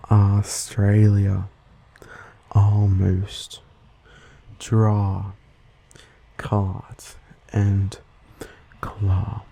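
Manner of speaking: American open "ah" in "draw" and "claw"; slow American vowels, word by word; American accent. Shown as a demonstration. Host imitating someone from the US, American accent. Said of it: "Someone from the U S would say these words like".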